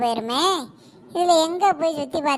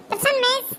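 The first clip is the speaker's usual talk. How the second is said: high-voiced schoolgirl; pupil answering roll; 8 semitones higher than usual. Ramya answers the teacher's roll call, high pupil voice.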